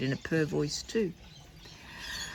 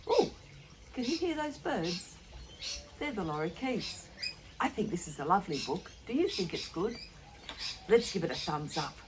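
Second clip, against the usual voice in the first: soft goodbye; 3 semitones higher, 8 dB quieter than usual. Acted. Soft sign-off to children.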